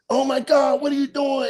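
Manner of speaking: high-pitched imitated outcry; mock shock. Acted shocked reaction on walking in.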